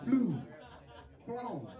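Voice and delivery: "Flu", then "Flown", each set apart and high; grammar correction. Shown as a demonstration. Comedian correcting the flyers' English, pointed correction. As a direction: give "Flu" and "Flown" as separate corrected forms. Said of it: "No, you are wrong".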